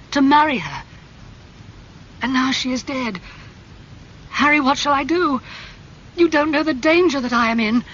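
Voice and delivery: put-on a frightened young man begging his friend for help; desperate young man confessing to Harry; distressed young Dorian.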